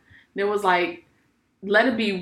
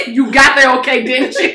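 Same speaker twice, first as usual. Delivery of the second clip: loud mock worry; 3 semitones higher, 9 dB louder, slower than usual. Mock concern, loud laughing.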